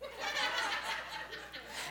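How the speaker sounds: high unvoiced cackle; breathy mocking laugh; man in next car laughing at dad.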